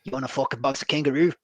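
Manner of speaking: hammed-up Aussie caricature. Shown as a demonstration. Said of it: "stereotypical if I'm gonna do an Australian accent".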